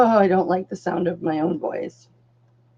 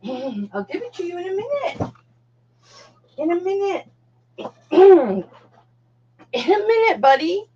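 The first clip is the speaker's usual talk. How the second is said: coaxing the dog; 7 semitones higher than usual. Real, not acted. Soothing dog waiting for treats.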